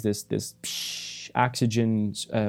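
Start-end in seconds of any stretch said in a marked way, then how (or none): 0.0-1.3 soft voiceless psh of leaking air; imitating an air leak with a hushed hiss. Pilot imitating the cockpit hissing noise, soft voiceless psh. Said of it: "he hears this noise".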